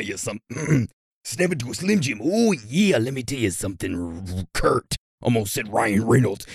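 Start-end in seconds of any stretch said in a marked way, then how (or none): 0.0-5.0 raspy, growling wrestler voice; hammed-up wrestling promo growl; Randy Savage. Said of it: "you want a Randy Savage birthday shout out".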